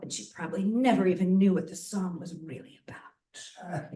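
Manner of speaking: put-on Vivian's gossipy accent, ending hushed. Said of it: "I don't know why I'm doing this accent".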